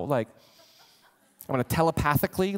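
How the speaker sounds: normal talking voice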